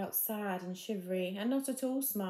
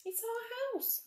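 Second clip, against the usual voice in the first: high squeaky mouse voice; a tiny mouse squeaking up high; slower than usual. Acted. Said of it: "squeaked their mother mouse".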